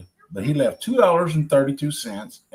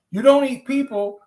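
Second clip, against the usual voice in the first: high, loud astonished question; disbelieving, voice jumping up; surprised; 11 semitones higher, 7 dB louder than usual. Acted. Old man surprised by dragon.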